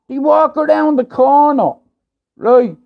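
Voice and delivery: excited. Real, not acted. Gang appears while he stands there.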